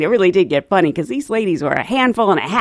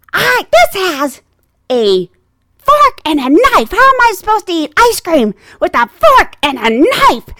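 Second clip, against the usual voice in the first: higher, loud, indignant mimicry; angry old lady; 9 semitones higher, 5 dB louder than usual. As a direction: complain in slow outrage as an elderly passenger. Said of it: "these ladies had some anger".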